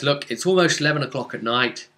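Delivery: usual speaking voice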